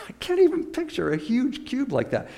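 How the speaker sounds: high laughing disbelief; amused laugh. Real, not acted. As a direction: giggle through the words. Laughs at the impossible cube.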